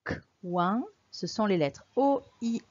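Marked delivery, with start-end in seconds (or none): 0.0-0.8 "K, ouin" split into two sounds, with a pause after K; sounded out. Shown as a demonstration. Teacher breaking down the word for a pupil, "K, ouin" sounded out in parts. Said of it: "Compte les syllabes du mot".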